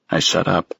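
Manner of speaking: ordinary delivery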